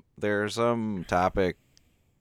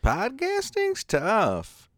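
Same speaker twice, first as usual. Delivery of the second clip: high mocking voice; slower than usual. Mocking echo, pitched high.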